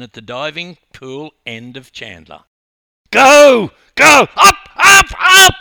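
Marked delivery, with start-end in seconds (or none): 3.1-5.5 much higher and louder screamed chant; screaming. Acted. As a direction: scream every word to lift her pace. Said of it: "I screamed".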